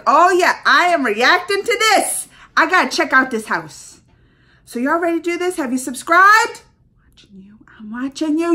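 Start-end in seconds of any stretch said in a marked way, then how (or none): 0.0-4.0 excited. Retold or imitated. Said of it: "I was like". 7.1-7.6 creepy playful whisper. Pretend. Playful subscribe reminder.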